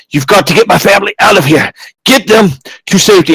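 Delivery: high, strained urgency; urgent pleading. Acted. Magistrate pulling the adventurer close, urgent plea. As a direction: plead fiercely for your family.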